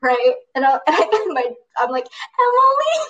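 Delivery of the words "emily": high, loud cry of a name; calling out. Retold or imitated. Calling the sister on the phone, a high loud wail. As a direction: call out her name in distress.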